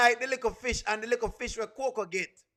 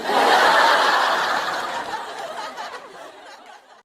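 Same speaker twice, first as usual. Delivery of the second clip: high, loud cackle; cracking up; loud cackling laugh; 14 semitones higher, 10 dB louder than usual. Real, not acted. Laughing hard to her viewers about the stoning line.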